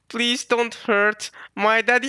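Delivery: put-on slow, high child-like plea; tearful pleading. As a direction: plead like a crying little girl. Voices crying child Bonnie pleading.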